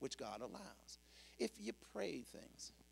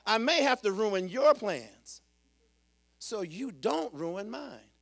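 loud, higher preaching cry; emphatic, raised sermon voice; forceful proclamation; 4 semitones higher, 14 dB louder, slower than usual. Real, not acted. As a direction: proclaim it boldly from the pulpit. Repeats line as a proclamation.

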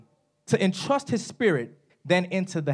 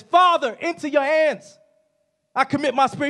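high, loud call on Father then easing; crying out; 6 semitones higher, 3 dB louder than usual. Acted. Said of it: "Jesus screams out".

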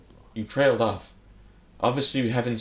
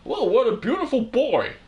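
a high, louder failed try at Goofy; Goofy voice attempt; 11 semitones higher, 4 dB louder than usual. Voicing a character meeting the baby, failed Goofy voice. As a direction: cheerfully mimic Goofy, not quite landing it. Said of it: "I'm trying to talk like Goofy".

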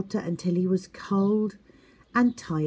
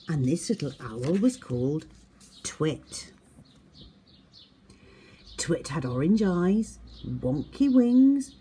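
ordinary delivery, nothing marked

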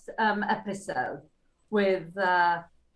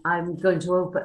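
normal talking voice

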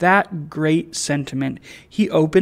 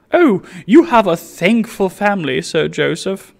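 higher, louder admiring lady; lady crying out in admiration, pitched up; cried out; 4 semitones higher, 8 dB louder than usual. Acted. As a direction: gush over him. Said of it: "cried his wife".

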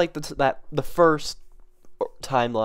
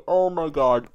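normal talking voice

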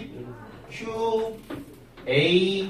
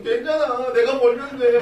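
carefree daughter; 7 semitones higher than usual. Retold or imitated. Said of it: "막 이러고 있어요, 철없이".

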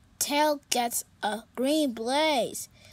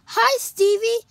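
higher, louder bright cat voice; cheerful kitty voice; 9 semitones higher, 9 dB louder than usual. Acted. Pete greeting his sad friend, high and cheerful.

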